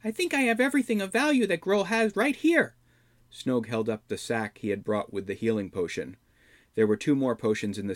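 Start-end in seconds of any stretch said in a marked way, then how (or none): 0.0-2.8 high, sly goblin voice, a bit louder; a smug little goblin showing off. Acted. Goblin voice held.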